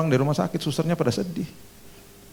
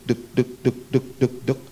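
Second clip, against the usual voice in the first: imitated beeps. Imitating temperature dropping.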